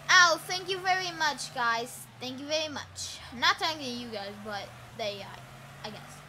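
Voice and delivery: ordinary delivery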